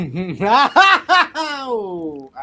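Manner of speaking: high, loud burst of laughter; big laugh. Real, not acted. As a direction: laugh out loud at your own joke. Laughing at own joke.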